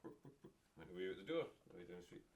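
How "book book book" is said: breathy little hen clucks, barely voiced; whispered chicken clucking.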